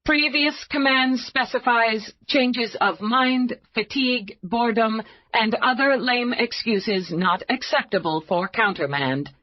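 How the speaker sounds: a cool machine reading out its rules; computer voice. Acted.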